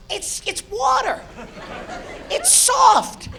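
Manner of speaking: high, soft falsetto innocence; mock naive voice. Comedian mocking his belief water is soft, high.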